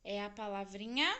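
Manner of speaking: ordinary talk, nothing marked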